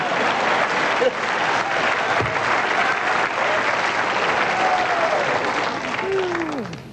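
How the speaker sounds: long airy laugh pitched high. Real, not acted. Host can't stop laughing at the robber story, high airy laugh. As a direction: lose it completely, laughing.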